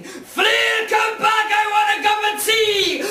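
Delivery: loud high bellow; giant shouting. Acted. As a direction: yell after the runaways at full tilt.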